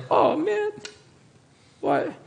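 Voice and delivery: high, soft, mocking dismayed whine; mock groan. Priest mocking a sad churchgoer, whining dismay. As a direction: parody a bored churchgoer moaning at prayer time. Mimics people sad at prayer meetings.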